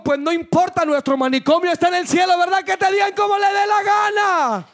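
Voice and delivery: high, impassioned exhortation to the congregation; preaching. Real, not acted. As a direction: build to a ringing shout at the end. Preaches at full force. (prédica exaltada)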